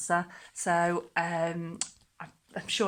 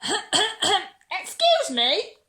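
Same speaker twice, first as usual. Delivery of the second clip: very slow, much higher little cricket; 12 semitones higher, 6 dB louder, much slower than usual. Acted. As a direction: pipe up like a tiny insect. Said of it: "coughed a cricket".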